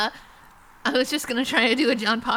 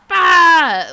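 sudden loud cartoonish bah; loud Pee-wee Herman bark; Pee-wee shout; 8 semitones higher, 15 dB louder than usual. Shown as a demonstration. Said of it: "a Pee-wee's Playhouse".

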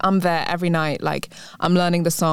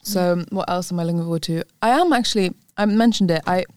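ordinary talk, nothing marked

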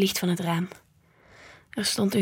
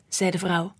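usual speaking voice

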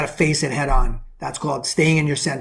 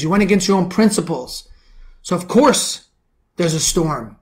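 ordinary delivery

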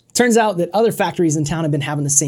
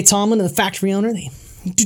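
normal talking voice

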